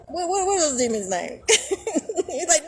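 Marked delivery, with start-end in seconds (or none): none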